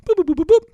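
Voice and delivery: playful beeping of dialed buttons; phone dial beeps. Retold or imitated. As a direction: imitate the dial tones of a phone. Dialing phone, imitating keypad tones.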